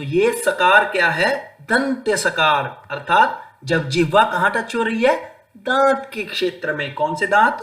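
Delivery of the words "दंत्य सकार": ordinary delivery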